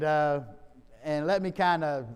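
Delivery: usual speaking voice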